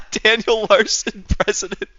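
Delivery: high, slow words broken by giggles; laughing. Real, not acted. To chat, giggling at his own silly president pick. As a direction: laugh through the name.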